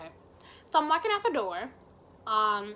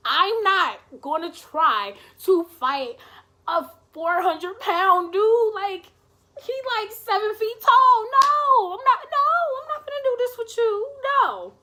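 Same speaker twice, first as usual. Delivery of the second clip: loud and slow at first, climbing to a high yell; outraged refusal; 11 semitones higher, 10 dB louder than usual. Retold or imitated. Teller refuses friend the job of fighting a huge man, loud protest. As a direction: build to a high, protesting holler.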